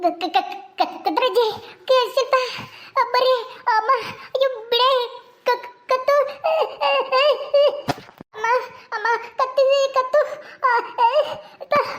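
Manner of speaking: high, broken, strangled stammer; choking. Acted. Woman with her neck squeezed calls for help, choking. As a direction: struggle to force each word past the grip.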